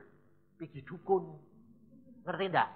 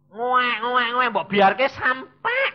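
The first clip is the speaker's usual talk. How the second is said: mock baby cry; 6 semitones higher, 8 dB louder, much slower than usual. Imitates a helpless baby's cry.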